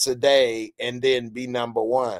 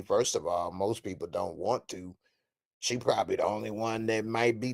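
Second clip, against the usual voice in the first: muttered aside; 4 semitones lower, 9 dB quieter than usual. Real, not acted.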